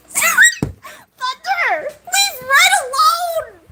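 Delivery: high, slow, drawn-out squeal; wailed protest. Real, not acted. Streamer begging Thunder to spare Red, drawn-out playful wail. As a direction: wail it high, stretching the words. Defending friend, mid-laughter.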